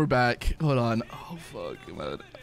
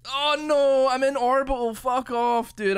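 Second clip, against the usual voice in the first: exasperated outburst; 10 semitones higher, 5 dB louder, slower than usual. Real, not acted. Game crash frustration.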